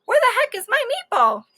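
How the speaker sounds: high, gleeful blurt; high excited question; excited quote. Acted. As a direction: say it like a greedy pup. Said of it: "before you can say".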